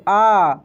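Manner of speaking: emphatic आ; loud open आ. Shown as a demonstration. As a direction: open wide on आ. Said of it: "पूरा मुंह खोलेंगे".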